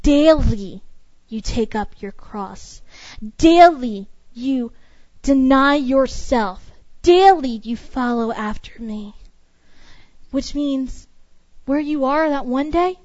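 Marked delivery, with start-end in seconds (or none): 0.0-9.1 solemn scripture quote, slow; stressed daily. Retold or imitated. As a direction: weigh every word, slowly. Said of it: "he says".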